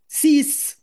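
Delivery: a lone "Six." thrown out high and loud; stress on six. Real, not acted. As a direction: hammer the single word "Six.". Outraged mother repeats six fines. (« Six. » lancé haut et fort, indigné)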